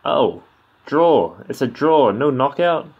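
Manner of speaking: loud, high surprised outburst. Real, not acted. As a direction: blurt the verdict in real surprise. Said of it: "I'm surprised".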